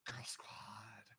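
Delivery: breathy secret whisper. Acted. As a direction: whisper it like a conspirator. Secret team name, hushed.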